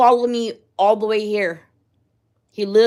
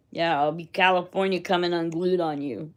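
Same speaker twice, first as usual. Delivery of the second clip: ordinary delivery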